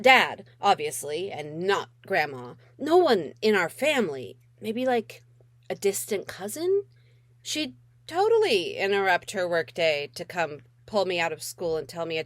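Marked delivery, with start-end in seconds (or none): none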